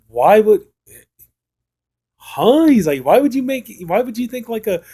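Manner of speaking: high-pitched mock incredulity; imitating someone totally baffled; baffled disbelief. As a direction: act out a stunned, high-pitched "huh?". Retold baffled reaction to the remark.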